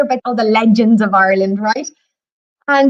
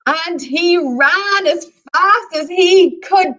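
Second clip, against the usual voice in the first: "ran" and "fast" stretched, higher; dramatic emphasis; 4 semitones higher, much slower than usual. Acted. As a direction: lean hard and slow on "ran" and "fast". Storyteller dramatizing the giant's flight.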